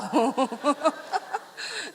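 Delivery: laughing at her own joke; hearty laugh. Real, not acted. Preacher laughing at her own story, hearty laugh. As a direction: let out a full laugh.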